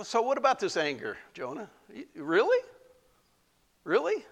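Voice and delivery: high, rising disbelief; God teasing Jonah about his anger; incredulous questioning. Acted. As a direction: ask it in mock disbelief. Preacher voices God questioning Jonah.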